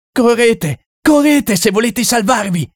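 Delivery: high, desperate cry to run; frantic shout. Acted. The Frenchman warns everyone to run, frantic shout. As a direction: yell a warning to flee. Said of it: "gridò il francese".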